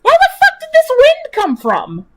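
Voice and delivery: high, startled cry at the wind; sudden panicked squeal; alarmed shriek. Real, not acted.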